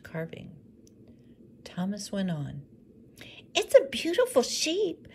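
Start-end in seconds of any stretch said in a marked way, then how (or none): 3.6-5.1 much higher and louder, admiring; boy describing his curly sheep; eager little boy. Acted. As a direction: praise it sweetly.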